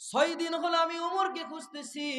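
emphatic repeat. Acted. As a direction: ring it out high to the crowd. একই কথা জোরে আবার বলা. (উঁচু গলায় জোর দিয়ে ঘোষণা)

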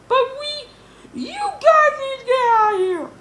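very high, loud order; Yesterday George interrupting. Acted. Yesterday George sending the boys out, high and loud.

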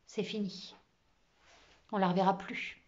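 subdued, gloomy statement; sombre voice. A narrator's reading. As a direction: say it darkly, as if all is lost. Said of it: "dit Aurore d'une voix sombre". (voix sombre et résignée)

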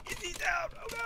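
high, panicked teammate cry; mock panic. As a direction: squeal it like a worried teammate going oh no. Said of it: "if people are like".

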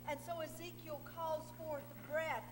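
usual speaking voice

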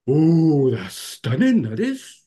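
wonderstruck, in a put-on Welsh lilt; acted amazement. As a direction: imitate a Welsh woman astonished at her healing. Acts out healed Welsh woman's amazement.

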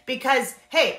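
ordinary talk

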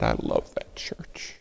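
slow, low, whispered tenderness; soft whisper. Retold or imitated. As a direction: hush it out like a mother's blessing. Mom's tender words quoted.

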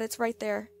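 normal talking voice

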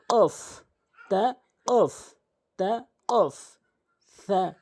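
normal talking voice

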